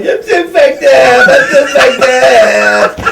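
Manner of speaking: high, long-drawn fake wail; mock crying. Host imitating his co-host high on ecstasy, mock crying. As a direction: wail it slowly, hammed-up sobbing. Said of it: "you were crying like".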